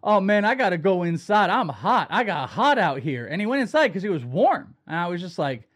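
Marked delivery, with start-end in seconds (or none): 0.0-3.3 louder, swooping mock ballplayer voice; Blake Snell impression. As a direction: mimic an overheated pitcher's complaint. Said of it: "he talked like this yesterday".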